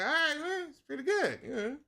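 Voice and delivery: high-pitched, pleasantly impressed aside; lifted voice of easy approval; approving reaction. Retold or imitated. Reacting on first hearing the song.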